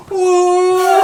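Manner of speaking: put-on kids gasping in awe, very high and loud; a crowd of kids shouting in amazement; amazed kids. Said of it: "all the kids go".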